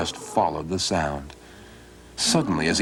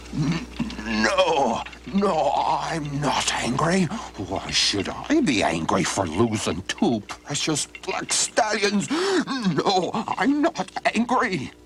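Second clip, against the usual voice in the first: suppressed anger; 7 semitones higher, slower than usual. Acted. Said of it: "had to hold his breath".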